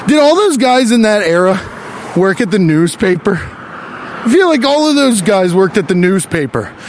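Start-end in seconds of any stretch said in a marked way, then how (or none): none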